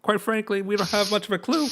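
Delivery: pompous soldier asking after orphans; Captain Willie. Acted. Captain Willie questioning the shopkeeper about missing orphans, high officious voice.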